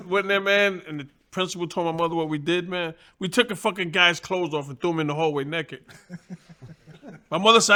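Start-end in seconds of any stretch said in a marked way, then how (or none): none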